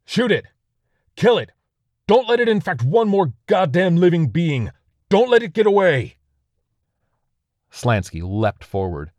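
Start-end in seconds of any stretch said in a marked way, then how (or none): 0.0-6.2 very high, loud frantic yells; an officer screaming at his troops to shoot; frantic shouting. Acted. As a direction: scream the order to open fire.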